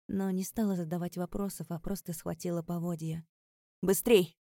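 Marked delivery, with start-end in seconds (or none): 3.8-4.4 loud, hurrying command; urgent shout. A narrator's reading. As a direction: call out to hurry someone along. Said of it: "крикнул Кадис".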